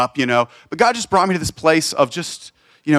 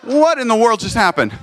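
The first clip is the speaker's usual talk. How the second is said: amazed; 7 semitones higher than usual. Retold or imitated. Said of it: "and I'm like".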